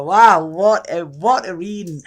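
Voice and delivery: slow, loud drawn-out wow; real awe; amazed wow. Real, not acted. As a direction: blown away by the reading. Amazed at the reading.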